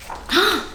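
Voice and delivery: sharp gasp; surprised gasp. Real, not acted. Reader surprised at the Whos, gasping.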